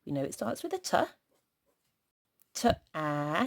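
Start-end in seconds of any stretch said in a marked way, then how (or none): none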